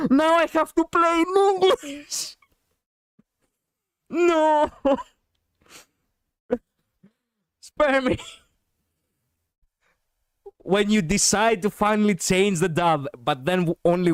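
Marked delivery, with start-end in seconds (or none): 0.1-8.2 high, drawn-out mock wail; pleading, exaggerated high cry; mock despair. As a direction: lament it like a hammed-up tragedy. Mock despair at playing in English.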